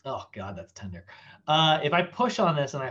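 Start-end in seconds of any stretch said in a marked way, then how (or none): 0.1-1.0 much softer, lower pained murmur; quiet wince. Real, not acted. Coach pressing his tender pec, quiet wince. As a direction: wince under your breath.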